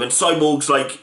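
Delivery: usual speaking voice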